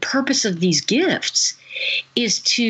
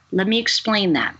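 normal talking voice

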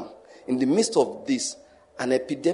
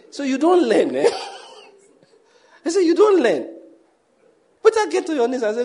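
laughing; 12 semitones higher, 6 dB louder than usual. Real, not acted. Mocking voters who never learn.

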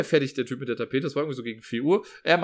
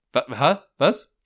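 baffled; 6 dB louder, much slower than usual. Retold or imitated. Baffled reaction to the installer.

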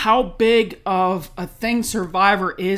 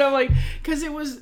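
normal talking voice

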